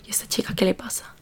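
hushed mock gossip whisper; imitating whispering onlookers. Onlookers on campus wondering about the crying girl, whispered. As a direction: whisper it like a puzzled passer-by. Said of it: "todo el mundo se me quedaba mirando así de que".

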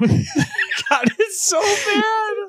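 high-pitched, drawn-out laughing complaint; cracking up. Real, not acted. Friend reacting to bad dialogue, laughing helplessly. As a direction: squeal it through laughter.

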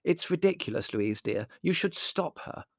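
a touch of huffy protest; bristling. A narrator's reading. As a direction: bristle with prim disapproval. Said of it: "bristling like a plump kitten".

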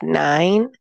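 ordinary talk

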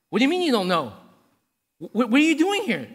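loud, high-pitched bafflement; confused angel. Acted. Angel questioning the thief arriving in heaven, confused. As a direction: demand an explanation, baffled and stumbling. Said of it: "all the angels all confused".

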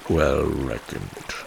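put-on low, slow answer of an old rat. A narrator's reading. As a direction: let the old rat confirm slowly.

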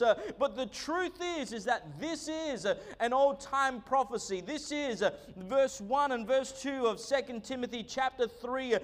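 rising, rolling sermon delivery; preaching. Acted. Pastor preaching to his church, fervent sermon voice. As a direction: preach it to the congregation with conviction.

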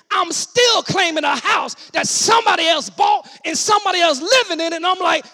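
loud, high, drawn-out proclamation; stretched pulpit cries with punched words; full-force preaching. Acted. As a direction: shout it out to the congregation.

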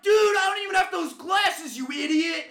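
high, very loud protest; indignant shout. Acted. Heckled fan answering the Dahmer taunt, indignant shouting. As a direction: yell back in outrage.

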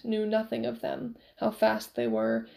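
usual speaking voice

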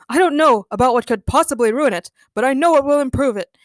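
lightly voiced eager boyfriend; eager suitor. A narrator's reading. Daniel hinting to Daniela at a proposal, eager.

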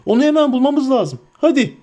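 high, agitated hobbit urging; urgent rallying cry. A narrator's reading. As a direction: urge everyone off, high and pressing. Sam's alarmed cry continues. (telaşlı, tiz sesle acele ettirerek)

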